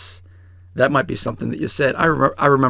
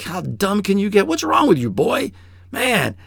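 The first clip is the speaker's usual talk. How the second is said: climbing higher, exasperated fatherly rebuke; mad scolding; 7 semitones higher than usual. Retold or imitated. A father scolding his son over locked keys, rising high and angry. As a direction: snap at your son in imitated anger. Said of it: "I would have been extremely mad".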